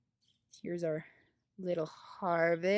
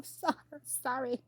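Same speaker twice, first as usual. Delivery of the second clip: normal talking voice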